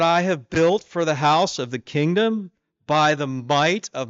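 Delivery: swelling kingly pride, stretched out slow; proud king. Acted. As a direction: puff up like a king admiring his city. King boasts over his Babylon.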